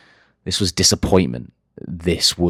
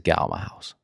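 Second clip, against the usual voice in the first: calm, quiet order; 2 semitones lower, 9 dB quieter than usual. Retold or imitated. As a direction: say it coldly calm, no raising. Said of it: "calmly kick her out of my house".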